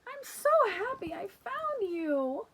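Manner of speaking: put-on high, loud happy little girl voice; relieved child. Lulu hugging Bingo, high and overjoyed. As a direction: gush with relief.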